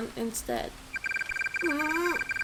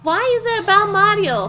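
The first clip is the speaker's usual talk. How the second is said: higher, louder frightened outburst; horrified Luigi; 7 semitones higher, 8 dB louder than usual. Acted. Luigi seeing horrible visions, louder fright. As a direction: recoil from the images.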